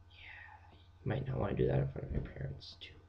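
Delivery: hushed whispered warning; low, soft confidential aside. Real, not acted. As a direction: whisper it as a friendly tip.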